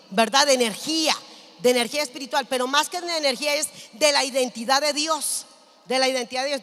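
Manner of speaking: loud, punchy sermon delivery; emphatic preaching. Real, not acted. Preacher teaching that the word is bread, loud and emphatic. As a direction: drive each point home to the congregation.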